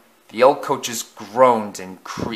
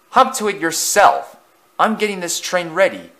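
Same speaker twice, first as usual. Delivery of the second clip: higher, scornful retort; scoffing; 7 semitones higher than usual. A narrator's reading. Thomas answering the bossy engine, scoffing. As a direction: toss it back with a scoff. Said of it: "Thomas scoffed".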